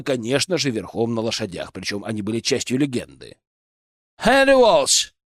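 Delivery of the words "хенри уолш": put-on high, bright Irish accent parody. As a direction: call it out in a hammy Irish accent. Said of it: "пародируя ирландский акцент Оливера".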